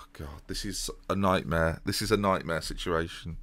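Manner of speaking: soft, sighing dismay; weary dismay. Real, not acted. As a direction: sigh it out low, weighed down. Dismayed at transfer dilemma.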